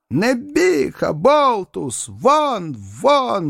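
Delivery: high-pitched, indignant cries to get out; outraged dismissal. A narrator's reading. Outraged mother ordering her daughter's suitor out of the house, indignant and high. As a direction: throw the suitor out in a high, drawn voice.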